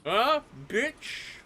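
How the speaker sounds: high, muffled bully with a full mouth; garbled, high-pitched mock menace; talking while eating. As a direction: threaten her through a mouthful of food. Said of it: "while I'm eating my sausage".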